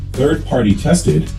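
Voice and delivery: ordinary talk, nothing marked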